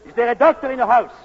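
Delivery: loud, fast call to the crowd; urgent call to the whole audience. Acted. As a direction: shout the question across the theater. Calling out to crowd.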